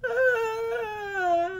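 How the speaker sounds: long, very high bawl; hammed-up high-pitched sobbing; wailing cry. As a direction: hold a loud drunken wail. Drunk character keeps bawling.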